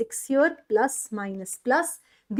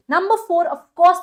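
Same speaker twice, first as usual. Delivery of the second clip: louder and higher on the step number; emphatic announcement; 6 semitones higher, 8 dB louder than usual. Real, not acted. Teacher announcing step four to students, louder. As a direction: punch out the next point.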